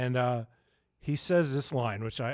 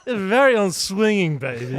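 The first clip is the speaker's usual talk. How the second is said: loud, higher hammed-up Austin Powers accent; 6 semitones higher, 7 dB louder than usual. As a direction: camp British swagger, Austin Powers style. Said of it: "Austin Powers".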